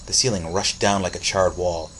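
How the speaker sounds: ordinary delivery, nothing marked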